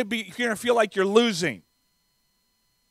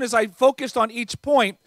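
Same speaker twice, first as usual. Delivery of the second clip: normal talking voice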